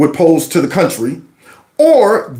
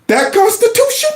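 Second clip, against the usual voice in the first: incredulous; 13 semitones higher than usual. Real, not acted. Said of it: "incredulous rhetorical question".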